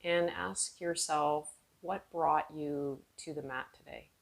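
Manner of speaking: calm, unhurried meditation voice with long pauses; guided meditation. Real, not acted. Yoga teacher guiding seated students inward, calm guided meditation. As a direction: ease them inward, letting phrases trail off softly.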